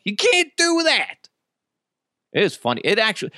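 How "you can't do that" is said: mock exasperated. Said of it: "I was like".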